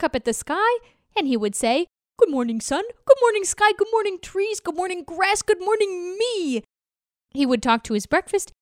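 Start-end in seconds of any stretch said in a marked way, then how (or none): 2.2-6.6 higher, sing-song morning greetings; cheery turtle greeting. Acted. Turtle greeting sun, sky and trees, bright and high. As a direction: greet the world brightly, one by one.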